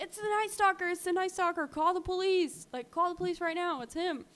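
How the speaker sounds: high, urgent imitation of alarmed boys; urgent calling out. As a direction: act out calling to onlookers for help. Retold boys calling out for help.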